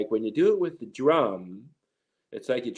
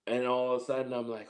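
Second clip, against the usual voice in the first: usual speaking voice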